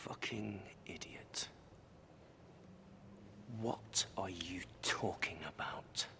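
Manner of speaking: harsh breathy whisper; whispered curse. Acted. Doctor whispering curses to himself after the accusation, unvoiced. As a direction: hiss it under your breath.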